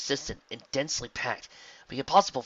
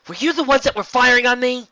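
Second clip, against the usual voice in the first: high, loud call across the sky; 15 dB louder than usual. A narrator's reading. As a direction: shout it up to the chariot. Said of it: "she called".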